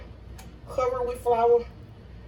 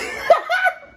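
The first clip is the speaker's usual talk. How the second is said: high burst of laughter; 8 dB louder than usual. Real, not acted. Cracks up during intro.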